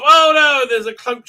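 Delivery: high, loud "Oh no"; playful startled cry; mock alarm. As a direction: yelp it in mock shock. Cloaked ship appears.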